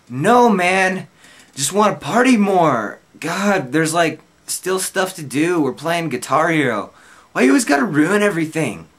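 put-on high, loud whining drunk; whiny drunk self. Drunk narrator whining at his friend Alan to keep partying. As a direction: whine like a kid who won't leave.

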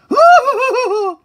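loud high cackle; maniacal laugh. Acted. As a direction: cackle with wild glee. Gleeful laugh.

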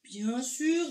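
lightly voiced big whale; a hint of the huge creature's drawl. A narrator's reading. Whale agrees to show herself to Argento, big whale voice. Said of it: "dit la baleine".

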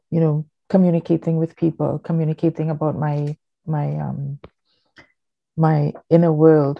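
normal talking voice

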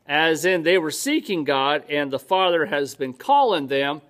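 ordinary talk

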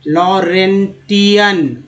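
लॉरेंटियन split into long slow syllables; careful stretched लॉरेंटियन; slow word demo. Shown as a demonstration. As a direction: sound out लॉरेंटियन for learners. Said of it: "इस तरह से आप पढ़ना सीख".

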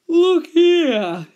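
high, loud yawned words; yawning koala. A narrator's reading. Sleepy koala interrupting Dingo, yawning. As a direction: stretch the words through a yawn. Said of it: "he yawned".

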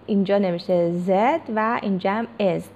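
ordinary delivery

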